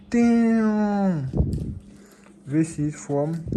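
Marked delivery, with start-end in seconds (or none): 0.1-1.3 loud amazed damn. Real, not acted. Amazed at the gift.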